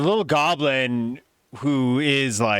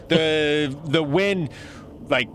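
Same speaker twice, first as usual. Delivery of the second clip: ordinary talk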